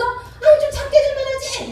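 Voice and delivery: high, exasperated spouse outburst; mock angry yell. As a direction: imitate blurting out a yell in anger. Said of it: "나도 모르게 소리를 버럭 지르면서".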